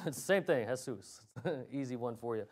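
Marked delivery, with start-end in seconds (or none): 0.7-1.2 "Jesus" said softly as "heh-SOOS"; soft H opening "Jesus", stress at the end; Tagalog pronunciation. Shown as a demonstration. Preacher giving the Tagalog name, "Jesus" as heh-SOOS.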